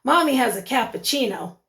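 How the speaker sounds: ordinary talk, nothing marked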